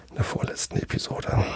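a quick whispered aside. Real, not acted. Geflüstertes Nebenbei. (geflüsterter Einschub)

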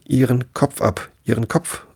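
high, hammed-up furious roar; bellowing queen. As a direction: roar the order at the girl. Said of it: "fing sie an zu brüllen".

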